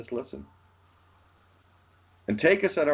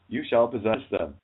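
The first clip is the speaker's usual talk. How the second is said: usual speaking voice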